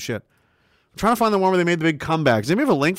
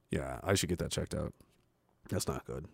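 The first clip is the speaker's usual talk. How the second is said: muttering; 19 dB quieter than usual. Real, not acted. Muttering to himself.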